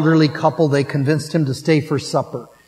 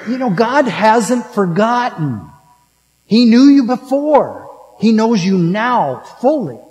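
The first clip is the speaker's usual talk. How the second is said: higher and louder, building conviction; rising preaching emphasis; 6 semitones higher, 3 dB louder than usual. Real, not acted. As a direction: lift it up like a proclamation. Sermon climax, preaching.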